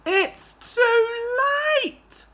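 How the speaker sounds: high strained cry fading to a groan; despairing groan. Acted. Duck groans as the trucks push him past the platform, strained and despairing. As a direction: give up with a groan. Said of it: "Duck groaned".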